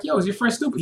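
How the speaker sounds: high put-on taunt; mock harlot taunt. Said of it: "he was like".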